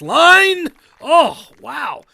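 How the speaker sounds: loud, high excited call; rising to a thrilled cry; excited shout. Real, not acted. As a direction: yell it as the ball drops. Excited call of a great shot.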